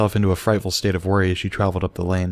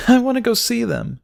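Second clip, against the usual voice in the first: high, tearful and small; sniffing. A narrator's reading. Crying filly asking to see them, sniffling. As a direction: say it between sniffles. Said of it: "she sniffed".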